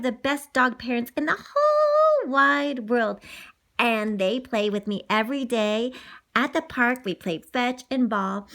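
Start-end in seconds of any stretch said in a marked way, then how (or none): none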